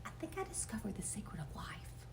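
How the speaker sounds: breathy secret whisper. A narrator's reading. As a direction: share a secret under your breath. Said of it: "I whispered".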